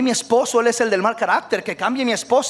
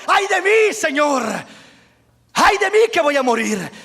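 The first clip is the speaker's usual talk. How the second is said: anguished lament; 9 semitones higher than usual. Acted. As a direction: pour out despair before the Lord. Isaías clama ante Dios. (lamento angustiado, agudo y fuerte)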